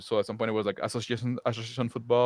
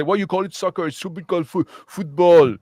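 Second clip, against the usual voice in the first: put-on French accent; 6 semitones higher, 7 dB louder than usual. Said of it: "I'm doing a French accent for, like, uh, British people".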